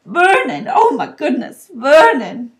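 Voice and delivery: high, loud horrified cries; aghast wife calling her husband; choking shock. A narrator's reading. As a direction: cry out as if about to faint. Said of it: "made a choking noise".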